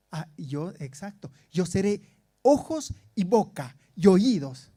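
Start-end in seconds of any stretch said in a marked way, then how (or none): 1.5-4.7 raised, emphatic mock apostle voice; imitated domineering apostle. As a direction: mimic a preacher laying down orders, loud. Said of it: "Así". (imitando a un apóstol mandón, con fuerza)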